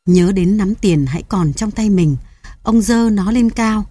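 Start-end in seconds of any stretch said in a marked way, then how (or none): none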